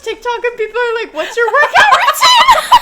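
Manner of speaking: high, loud, cracking up while talking; laughing shout. Real, not acted. Co-host joking about killer's TikTok, laughing shout. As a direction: shriek it through real laughter.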